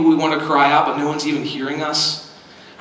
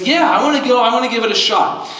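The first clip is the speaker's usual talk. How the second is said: higher, eager mock-child declaration; brave daughter; 6 semitones higher, faster than usual. Daughter tells dad she will try the giant slide, bravely. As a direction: lightly imitate a little girl feeling brave. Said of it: "was feeling really brave".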